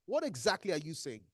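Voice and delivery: usual speaking voice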